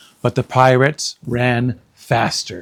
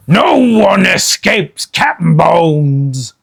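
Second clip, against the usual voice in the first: slow, loud pirate roar; Captain Bones roaring at captives; 5 semitones higher, 5 dB louder, slower than usual. Acted. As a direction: roar the threat. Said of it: "he roared".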